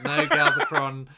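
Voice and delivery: high, loud laughing protest; laughing exasperation. Retold or imitated. Zarak warning Galvatron, high loud laughing. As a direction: laugh at his foolishness.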